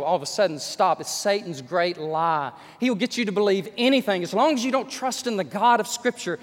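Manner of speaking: ordinary talk, nothing marked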